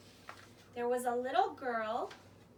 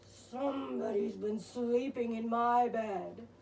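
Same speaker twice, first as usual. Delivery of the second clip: much lower gruff bear voice; growling Papa Bear; 5 semitones lower than usual. Acted. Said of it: "Papa Bear growled".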